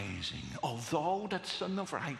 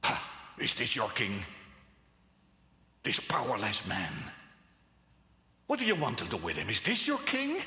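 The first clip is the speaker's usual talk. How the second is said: biting off; 6 semitones lower, faster than usual. Retold or imitated. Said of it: "it's like he bites off".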